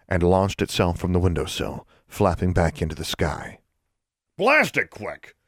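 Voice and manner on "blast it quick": snarled. Acted. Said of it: "snarled Thiel".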